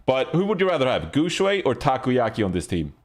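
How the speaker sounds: usual speaking voice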